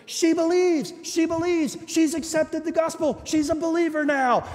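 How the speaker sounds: high-pitched, breathless joy; excited news. Retold or imitated. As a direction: blurt it out, barely containing delight. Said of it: "She goes".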